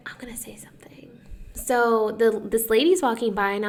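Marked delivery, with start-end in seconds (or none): 0.1-1.1 fast hushed whisper to herself. Retold or imitated. Working up nerve at the salon, whispered. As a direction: psych yourself up under your breath. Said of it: "So I was like".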